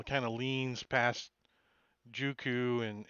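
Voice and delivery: ordinary talk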